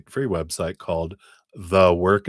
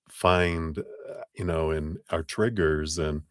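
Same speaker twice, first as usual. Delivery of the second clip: ordinary delivery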